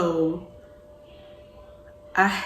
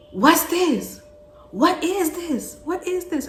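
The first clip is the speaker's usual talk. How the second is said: high, louder puzzled father; imitating dad, pitched up and confused; 11 semitones higher, 4 dB louder than usual. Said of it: "so he goes".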